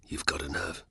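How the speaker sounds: hissed under the breath; menacing whisper. Acted. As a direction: whisper it with cold anger. Spartacus's lips thinned, furious.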